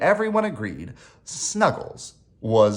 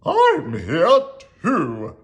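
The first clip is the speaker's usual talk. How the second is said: mild cry, raised, louder and drawn out; 5 semitones higher, 6 dB louder, slower than usual. A narrator's reading. Owl answers frightened Rabbit, mild drawn-out cry. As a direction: call it out slowly, a little indignant. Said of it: "Owl cried".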